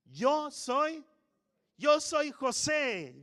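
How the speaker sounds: high, loud tearful cry, slowed. Acted. Joseph reveals himself to his brothers, weeping loudly. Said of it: "llorando entre lágrimas".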